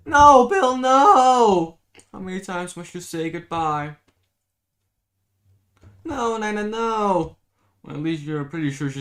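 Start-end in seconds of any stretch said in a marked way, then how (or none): 0.1-7.4 loud, high anguished no; wailing no at a lost friend; anguished cries. Acted. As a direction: cry out in despair. Dream cries of despair.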